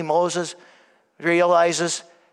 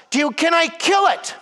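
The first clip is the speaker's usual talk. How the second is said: eager angel; 10 semitones higher than usual. Retold or imitated. Angel offers to kill demon.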